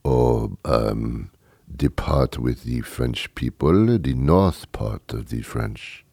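put-on low, soft, slow French accent; hesitant, drawn-out mock-French voice; phony French accent. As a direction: stall for an answer in a fake French accent. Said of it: "you do it with a French accent".

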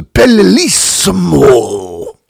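"bellissimo" drawn out high and loud in a hammed-up Italian accent; mock Italian accent. Mocking the TV cook's sudden Italian, "bellissimo" slow, high and loud. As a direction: stretch "bellissimo" slowly, rolling it like a TV Italian chef. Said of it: "she turns very Italian".

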